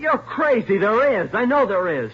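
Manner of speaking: loud, high-pitched denial; desperate insistence. Acted. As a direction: protest with rising panic. Suspect insisting under interrogation.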